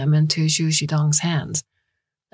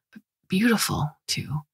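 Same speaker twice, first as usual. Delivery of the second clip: murmured; 3 semitones higher, 5 dB quieter than usual. Acted. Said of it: "Liang Yuan murmured".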